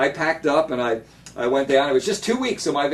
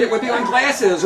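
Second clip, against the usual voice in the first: ordinary delivery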